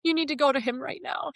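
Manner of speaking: fighting tears while quoting the pastor; getting emotional. Real, not acted. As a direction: voice rising with held-back tears. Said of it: "I'm gonna get very emotional".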